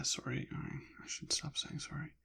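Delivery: ordinary talk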